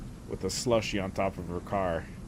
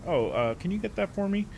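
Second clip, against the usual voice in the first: mock nice voice; 9 semitones higher than usual. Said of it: "just switched from".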